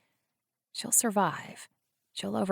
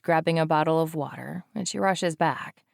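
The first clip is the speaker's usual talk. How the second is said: plain reading voice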